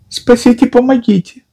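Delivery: high thin squeaky cry for help. A narrator's reading. Piglet calls for help in a squeaky voice. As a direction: squeak it out like a tiny trapped creature. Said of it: "снова писнул голосок".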